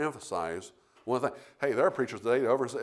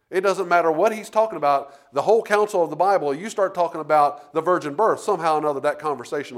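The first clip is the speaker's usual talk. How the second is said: louder, higher pulpit voice; fiery preaching; 4 semitones higher, 6 dB louder than usual. Acted. Preacher warning the congregation about overemphasis, fiery preaching.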